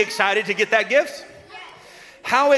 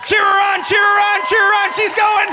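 high, loud rallying cry; cheering shout; 13 semitones higher, 6 dB louder than usual. Real, not acted. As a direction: whip up the crowd as she runs. Urging crowd as child runs.

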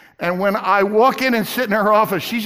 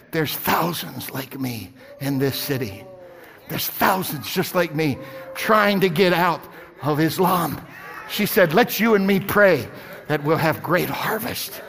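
softer and lower throughout; soft confiding; 8 semitones lower, 5 dB quieter than usual. Retold or imitated. Banker confides to preacher, soft and low. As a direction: confide it gently. Sherry's confiding words retold.

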